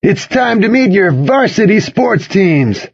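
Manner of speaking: high, slow pep-rally announcement; drawn-out hype emcee call, pitched high; booming announcer. A narrator's reading. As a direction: announce it to the whole gym. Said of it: "he boomed".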